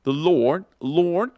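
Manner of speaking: "Lord" alone with a rising tune and a clear r; "Lord" on its own, rising; isolated Lord. Shown as a demonstration. Teacher picks out one word for students: "Lord" with a rising tune. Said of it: "como en la anterior".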